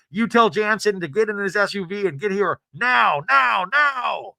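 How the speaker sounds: urgent walkie-talkie command voice; hammed-up producer on a radio; frantic producer. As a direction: bark orders like a panicked producer.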